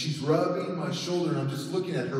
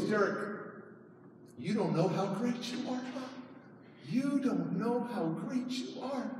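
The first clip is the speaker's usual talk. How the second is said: soft, higher, crying plea; tearful; 5 semitones higher, 9 dB quieter than usual. Retold or imitated. Crying counselor telling Derek his worth, tearfully. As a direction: plead gently through tears. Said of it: "she's just crying".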